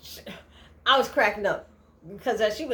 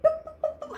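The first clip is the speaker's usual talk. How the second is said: high playful "boop" counting noises; counting beeps. Retold or imitated. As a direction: tick off each curse with a sound. Counting curses with sounds.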